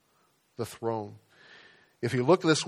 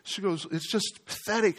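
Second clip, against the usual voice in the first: lightly voiced fretting mother, higher; a hint of his anxious mom, pitched up; worried mom; 6 semitones higher than usual. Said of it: "and she says".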